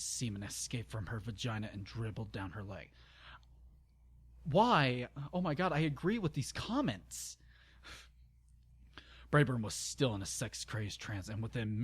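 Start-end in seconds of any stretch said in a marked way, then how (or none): 4.5-7.4 high wail easing into a complaint; exasperated dismay. Real, not acted. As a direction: cry out in pained disbelief at the text. Reader recoils from the story.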